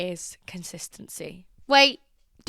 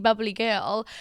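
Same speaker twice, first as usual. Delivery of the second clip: ordinary talk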